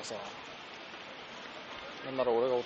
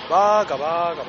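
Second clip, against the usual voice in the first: teasing; 2 semitones higher, 9 dB louder than usual. Real, not acted. Teasing friend who forgot pen.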